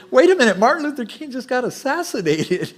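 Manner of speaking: high, loud mock disbelief; wait, what, are you serious; incredulous. Preacher mocking the calm crowd's reaction, incredulous. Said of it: "And it's like".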